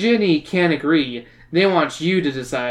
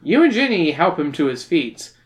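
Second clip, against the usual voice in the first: ordinary delivery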